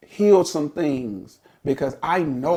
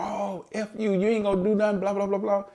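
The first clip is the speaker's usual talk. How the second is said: put-on taunting girl; 8 semitones higher, faster than usual. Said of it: "she kept on going".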